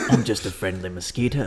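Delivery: jokey, low-key gravelly British voice; gravelly British accent. Acted. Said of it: "the gr-, the gravelly one".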